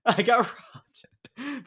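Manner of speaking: whispered slowly through laughter; can't finish the line for laughing; breathless giggling whisper. Retold or imitated. Mom voice dissolving in laughter.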